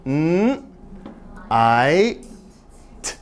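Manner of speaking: "n", "I", "t" said as separate sounds; sounding out night. Shown as a demonstration. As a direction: segment each sound slowly. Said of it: "the word night has, has three sounds".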